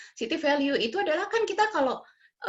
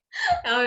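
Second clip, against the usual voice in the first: short, high-pitched laugh. Real, not acted. Speaker laughs after marvelling at her husband, high laugh. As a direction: burst into a brief high laugh.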